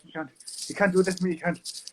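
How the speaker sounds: lightly put-on drunk Irishman, fast; drunk Irish guy. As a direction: rattle it off like a drunk Irish bloke at the door. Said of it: "He'd be like".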